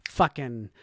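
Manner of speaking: ordinary talk, nothing marked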